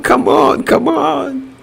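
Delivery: rising, high-pitched plea for a win; eager urging. Real, not acted.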